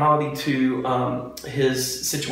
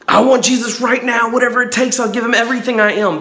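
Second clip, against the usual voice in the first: higher, louder, fast desperate cry; desperate plea; 9 semitones higher, 6 dB louder than usual. Retold or imitated. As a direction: blurt it like you'd give up everything right now. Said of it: "That desperation".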